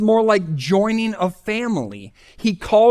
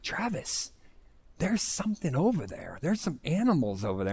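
nervous hushed warning; 11 dB quieter than usual. Retold or imitated. Sister hears rustling in the woods, hushed nervous warning. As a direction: warn your brother quietly that something's in the woods. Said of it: "my sister's getting a little paranoid".

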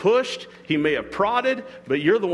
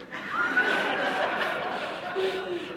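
airy high wheeze of a laugh; breathless laugh; 6 dB quieter than usual. Real, not acted. Preacher laughing at his own hook joke, airy laugh. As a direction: let a silent laugh escape.

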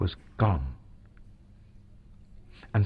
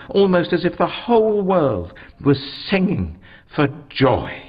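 plain reading voice

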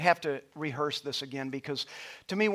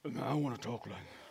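deep voice attempt; 6 semitones lower, 12 dB quieter than usual. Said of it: "I can't even begin to pretend".

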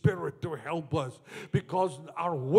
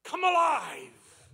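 high, drawn-out cry; slow, high commanding shout; bold command; 13 semitones higher, 8 dB louder, slower than usual. Shown as a demonstration. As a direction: call it out with bold conviction. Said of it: "you say it with boldness".

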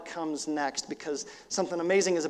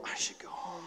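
unvoiced hushed question; whisper; 16 dB quieter than usual. Real, not acted. Preacher whispering the son's hope of going home. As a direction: breathe it like a secret. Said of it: "whispered question".

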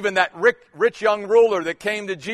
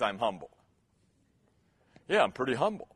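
mock smug murmur; 8 semitones lower, 10 dB quieter, faster than usual. Mock self-satisfied humility.

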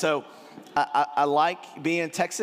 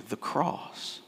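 whispered answer; 5 dB quieter than usual. Real, not acted. Preacher answers his own question.

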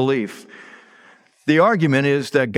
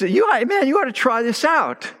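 higher, eager pitch to his friend; talking up the rocker to Pace, higher and keen; urging a friend; 8 semitones higher than usual. Retold or imitated. Uncle urging his friend to try the rocker, higher voice. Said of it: "and I said to Pace".

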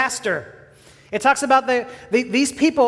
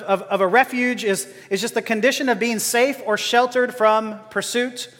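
normal talking voice

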